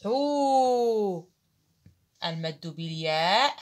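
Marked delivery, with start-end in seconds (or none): 0.0-1.3 حو held as a long "ḥuu", higher; teacher sounding a long vowel. Shown as a demonstration. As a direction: stretch حو into a long u. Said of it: "أقول لها".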